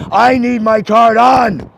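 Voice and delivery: loud, high and slow, each word pressed; slow angry insistence. Real, not acted. Customer insisting to the bank agent, slow and angry. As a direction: spell it out angrily.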